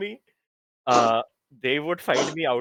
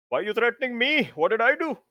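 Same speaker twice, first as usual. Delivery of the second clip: high-pitched, quick whine of innocence; indignant protest; 10 semitones higher, faster than usual. Acted. Hero protests to a teammate hauling them away, indignant. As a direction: protest as if wrongly picked on.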